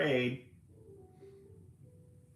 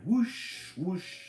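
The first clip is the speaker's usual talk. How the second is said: higher whooshing sound effect; whooshing wind; 10 semitones higher, 5 dB louder than usual. Acted. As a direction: whoosh like big wings.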